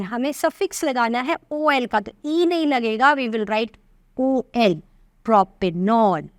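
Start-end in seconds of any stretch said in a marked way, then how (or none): 4.2-6.3 slow dictation. Shown as a demonstration. Teacher dictating the final name.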